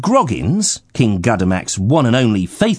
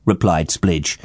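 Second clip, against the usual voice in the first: usual speaking voice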